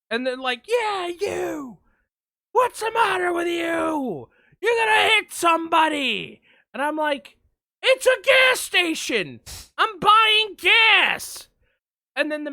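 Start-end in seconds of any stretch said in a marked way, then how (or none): 0.7-6.4 high mock shout; retold bullhorn yell. Bullhorn person yelling at the driver, high mock shout. 7.8-11.5 high, loud, slowed yell of disbelief; exasperated retold shout. Driver yelling back at the bullhorn person, high exasperated shout.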